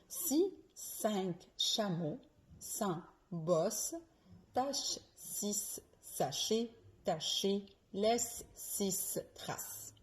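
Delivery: "Si cinq chameaux sans bosse" slowly, S hissed, CH hushed; slow S and CH drill. Shown as a demonstration. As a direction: separate every S from every CH. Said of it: "je répète encore". (virelangue lente, S et CH bien distincts)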